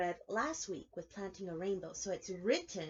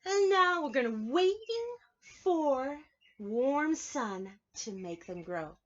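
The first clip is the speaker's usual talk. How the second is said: storybook reading; 5 semitones higher, 6 dB louder, slower than usual. A narrator's reading. Reading picture book aloud.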